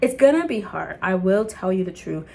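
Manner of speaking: usual speaking voice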